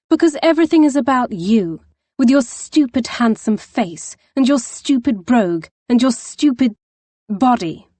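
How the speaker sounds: high, loud tearful outburst; furious. Acted. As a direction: rant at him in frustration. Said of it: "She gestured to him furiously".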